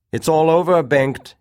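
loud, high gloating colonel; triumphant officer. A narrator's reading. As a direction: crow over a beaten rival. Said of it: "he said".

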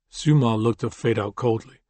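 plain reading voice

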